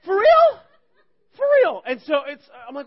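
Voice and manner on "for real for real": high, loud exclamation; mock kid outburst; imitated kids' reaction. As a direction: blurt it out, pitched way up. Kids' reaction to the shaking plane.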